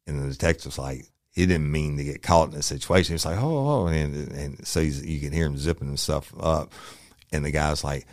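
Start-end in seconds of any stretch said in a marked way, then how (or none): 3.4-3.9 high-pitched startled reaction, acted out; mock-alarmed, pitch leaping high. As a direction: imitate a man caught off guard, voice jumping up. Caught off guard while urinating.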